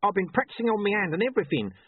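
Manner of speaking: high-pitched, chatty watchman; eager little watchman voice; Corporal Nobbs. A narrator's reading. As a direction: natter on helpfully in a high character voice.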